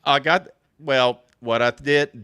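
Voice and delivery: normal talking voice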